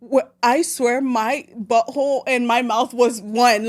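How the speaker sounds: ordinary talk, nothing marked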